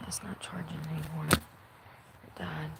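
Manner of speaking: hushed whisper. Real, not acted. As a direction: whisper so no one hears. Whispering at night outdoors.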